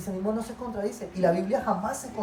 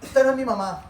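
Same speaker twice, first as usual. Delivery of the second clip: mock angry outburst, raised and louder; retold teenage anger; 5 semitones higher, 5 dB louder than usual. Angry teenager talking back to mother, mock outburst. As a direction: act out a teen lashing out. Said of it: "uno se enojaba y decía".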